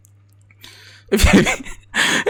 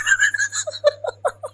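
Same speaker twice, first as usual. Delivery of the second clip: a high-pitched shriek of laughter; 5 dB quieter than usual. Real, not acted. Hysterical laughter at comment. (tawa melengking tak tertahan)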